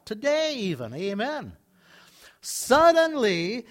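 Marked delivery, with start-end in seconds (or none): 2.4-3.7 "Suddenly" stretched out slowly and raised; slow, drawn-out "Suddenly" for effect. Acted. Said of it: "Everybody say, "Suddenly".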